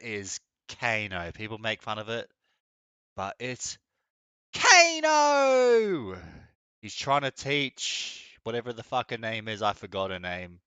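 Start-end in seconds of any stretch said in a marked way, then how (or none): 4.5-6.2 excited shout. Real, not acted. Excited shouting the character name.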